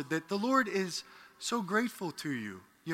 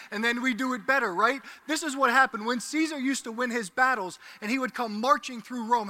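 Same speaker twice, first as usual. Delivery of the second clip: preaching at full force; 6 semitones higher than usual. Acted. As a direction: proclaim it to the whole church.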